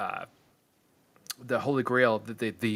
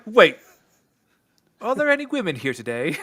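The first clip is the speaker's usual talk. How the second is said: role quote; 6 semitones higher, 5 dB louder than usual. Retold or imitated. Acting a woman in stoning scene.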